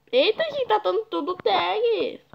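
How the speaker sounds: jumps way up high on eita; high startled eita. Real, not acted. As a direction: yelp of surprise, then settle. Sudden surprise at game tags.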